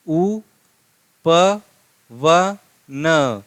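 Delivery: उपवन stretched very slowly; drawn-out reading. Shown as a demonstration. Teacher reading उपवन aloud to students, very slowly. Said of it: "हमने लिखा है यहाँ पे उपवन".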